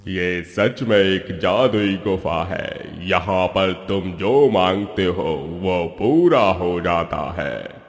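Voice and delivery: low, slow, booming divine voice; voice from the sky. Acted. Magic cave answering the lazy man, booming divine voice. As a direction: speak as an unseen god from above. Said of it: "एक आकाशवाणी होती है".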